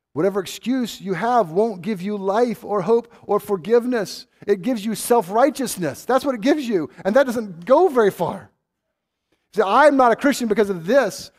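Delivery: loud, high, pressing sermon; fiery preaching. Acted. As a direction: drive the warning home at full force. Preaching at full force.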